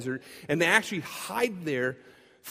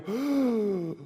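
stunned realization; 4 semitones lower, 6 dB quieter, slower than usual. Retold or imitated. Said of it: "they kinda go".

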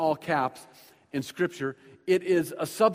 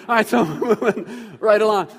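real laugh, then louder and quicker to move on; 5 semitones higher, 8 dB louder than usual. Real, not acted. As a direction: laugh at your own joke and push ahead. Laughs at his own pun.

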